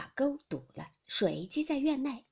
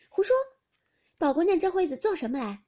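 dismissive retort; 7 semitones higher, 7 dB louder than usual. Acted. Maid scornfully contradicts another maid.

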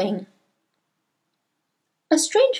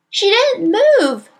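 high, slow protesting cry; children leaping to a classmate's defence; high-pitched indignant outcry; 10 semitones higher, 4 dB louder, slower than usual. A narrator's reading. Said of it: "they cried out".

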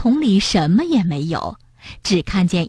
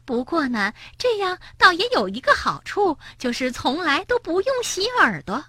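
higher mocking tease; teasing Suqi; 8 semitones higher, 5 dB quieter than usual. Suqi teasing the frog about his ears, mocking. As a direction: rub the joke in gleefully.

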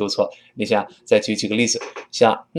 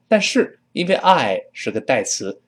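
ordinary talk

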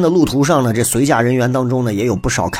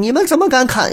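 much higher, outraged demand that falls at the end; indignant challenge; 11 semitones higher than usual. Retold or imitated. The chancellor confronting the soldiers, in a high outraged voice. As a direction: cry out in shock at the soldiers.

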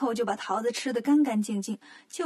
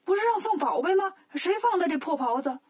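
angrier king; 5 semitones higher than usual. Acted. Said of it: "国王更生气了".

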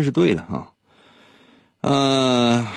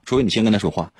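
ordinary talk

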